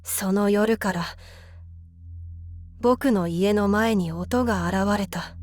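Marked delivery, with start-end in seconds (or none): none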